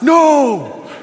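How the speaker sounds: high, loud panicked cries of no; sideline yelling no. Retold or imitated. Parents on the sideline yelling at Bobby to stop his free kick. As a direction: shriek no like a whole crowd of parents.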